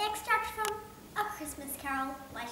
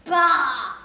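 put-on a loud, explosive old miser's "Bah!"; Scrooge's scoff; 2 semitones higher, 17 dB louder than usual. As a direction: spit it out with contempt.

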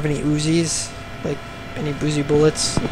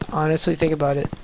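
ordinary talk

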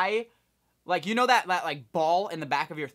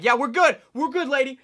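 higher, louder breezy dismissal; loud brush-off; 10 semitones higher, 10 dB louder than usual. Retold or imitated. Being escorted past staff, a loud breezy brush-off. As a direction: wave them off. Brushing off people stopping them.

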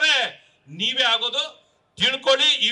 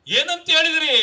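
raised, higher rally shout; louder, higher, with a falling end; loud demanding repeat; 4 semitones higher, 6 dB louder than usual. Real, not acted. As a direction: throw the question out hard at your opponents. Repeats question forcefully at rally.

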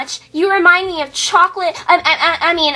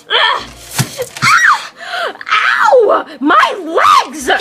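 pained yell; 10 semitones higher than usual. Acted. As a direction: yell out in pain. Injured in chaos.

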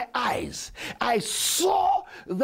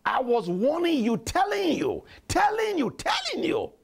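insistent warning. Real, not acted. Preacher reminding church of his ignored warnings, insistent and climbing.

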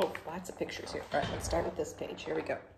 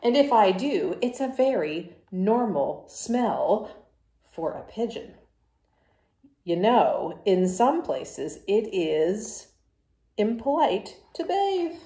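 loud, brash pigeon voice, brushing it off; the pigeon, loud and sure of itself, to the kids; cocky pigeon; 3 semitones higher, 11 dB louder, slower than usual. Acted. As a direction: play the stubborn pigeon who dodges the bath. Pigeon defending its smell.

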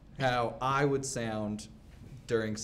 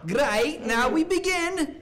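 very high, loud hammed-up proclamation; comic shrieky ceremonial opening; mock bedroom announcement; 7 dB louder, slower than usual. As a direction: launch it high and loud, stretching the end. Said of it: "Wait, one more time?".

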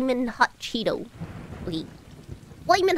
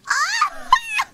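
very high shriek of delight; piercingly high happy scream; delighted squeal; 4 dB louder than usual. Real, not acted. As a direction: squeal at something adorable. Squeals at cats kissing.

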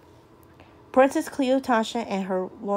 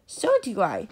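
usual speaking voice

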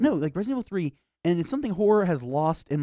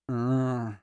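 zombie moan; 6 semitones lower, slower than usual. Retold or imitated. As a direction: moan for brains like a dead man. Imitating slow zombies.

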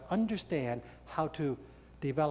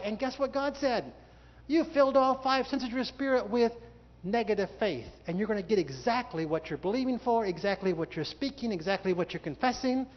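higher, louder preaching voice; preaching at full force; 6 semitones higher, 4 dB louder than usual. Real, not acted. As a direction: preach the judgment out to the hall.

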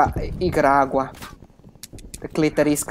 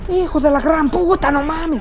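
high, loud Spanish curse; Spanish outburst; 13 semitones higher, 4 dB louder than usual. Real, not acted. Cursing loudly in Spanish at the game.

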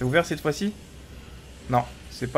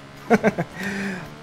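laughing; 8 semitones higher than usual. Real, not acted. Laughs at the bug.

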